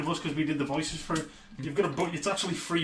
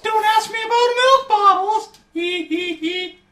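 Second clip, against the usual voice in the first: goofy toy voice; 10 dB louder, slower than usual. Acted. Toy phrase, loud high voice.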